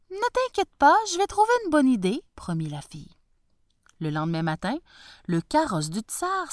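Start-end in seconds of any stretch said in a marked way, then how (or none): 0.1-2.2 high, quick reassurance; reassuring daughter. Acted. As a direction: comfort your father brightly.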